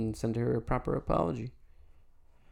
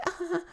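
a brief polite horn tap; little honk. Retold or imitated. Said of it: "a nice little honk".

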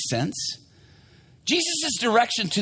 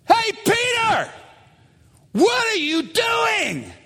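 mocking call-out; 10 semitones higher than usual. Shore crews heckling Peter again, mocking. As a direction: shout in high-pitched disbelief. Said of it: "crews back at shore are going".

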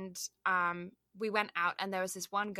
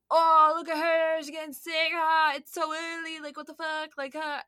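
high, loud mocking jeer; jeering guys; 8 semitones higher, 7 dB louder than usual. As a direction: sneer at the sick girl like a rowdy lout. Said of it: "they're jeering".

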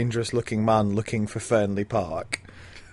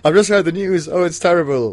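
mock distraught; 6 semitones higher, 6 dB louder than usual. Mocking Geoffrey Raymond's entrance.

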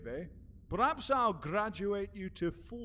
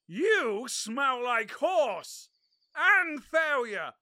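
slow, drawn out; 5 semitones higher, 5 dB louder, much slower than usual. Acted. Catesby sniffs his friend slowly.

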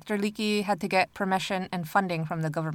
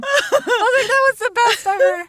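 high, loud laughing squeal; cracking up mid-sentence; laughing hard; 9 dB louder than usual. Real, not acted. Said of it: "I was cracking up all over again".

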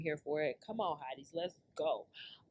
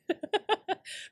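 sudden laugh, much higher pitch; high burst of laughter; 11 semitones higher than usual. Real, not acted. Laughing at her own threat to fellas, high burst. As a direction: crack up at your own joke.

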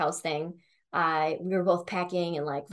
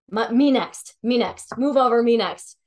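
loud, pushy me next; eager clamouring; 4 semitones higher, 6 dB louder than usual. Retold or imitated. Friends clamouring for a tarot reading, loud and eager. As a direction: shove to the front of the line.

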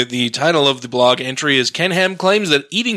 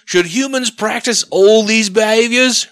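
higher-pitched, attempted Australian accent that lands British; bad Australian accent; 8 semitones higher than usual. Reading Ham's blog aloud, in a bad Australian accent. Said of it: "I can't do Australian".

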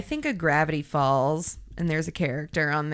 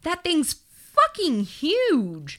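amazed; 10 semitones higher, slower than usual. Real, not acted. Seeing the huge sluice.

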